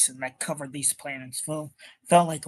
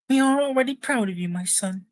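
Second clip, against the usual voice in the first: high, put-on mom voice; proud mother in falsetto, dropping at the end; 9 semitones higher, 3 dB louder, faster than usual. Voicing the mother to her son.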